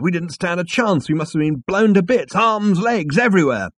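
high, loud, worked-up telling; excited. Acted. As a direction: pile on the gory details. Ford excitedly recounts the blast.

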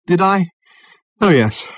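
surprised. A narrator's reading. Ford surprised reply.

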